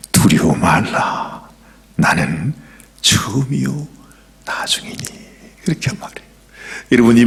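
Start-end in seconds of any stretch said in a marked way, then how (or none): 0.1-5.0 low, slow, gentle words of Jesus; low and unhurried; solemn quote. Acted.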